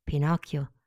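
ordinary delivery

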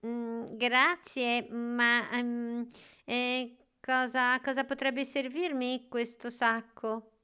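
put-on high, hesitant child voice; unconvinced little boy groping for words; puzzled boy. A narrator's reading. Boy puzzled by empty sack.

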